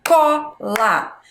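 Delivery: "Cola" in two beats, CO, gap, LA; syllables as for clapping. Shown as a demonstration. Teacher clapping out words for pupils, "Cola" split in two. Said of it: "Posso bater palma se eu quiser".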